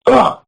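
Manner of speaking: a wince turned into a sound; real groan at the memory; disgusted groan. Real, not acted. Reacting to head hitting ground.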